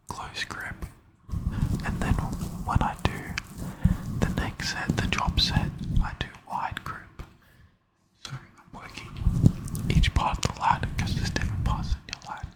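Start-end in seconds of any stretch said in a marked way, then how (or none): none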